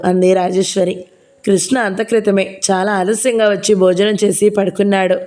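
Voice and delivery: ordinary delivery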